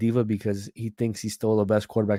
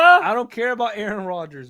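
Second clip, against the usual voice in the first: higher and louder, with energy; energetic; 10 semitones higher, 6 dB louder than usual. Real, not acted. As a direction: wake up and punch it out. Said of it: "Wake up, bro".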